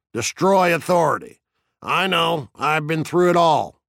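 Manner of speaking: high-pitched, grandiose quoting; taunting fire captain. A narrator's reading. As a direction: declaim quotations to needle him. Narrator voices Beatty lecturing Montag.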